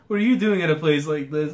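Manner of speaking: ordinary talk, nothing marked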